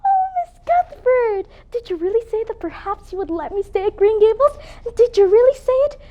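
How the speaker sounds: breathless hope. Acted. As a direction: lift every word with eager hope. Orphan begs to stay.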